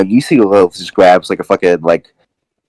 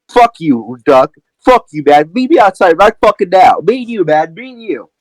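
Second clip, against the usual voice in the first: high-pitched, heated callout; angry challenge; 8 semitones higher than usual. Acted. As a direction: call him out to fight, pitch climbing. Acted challenge to a fight.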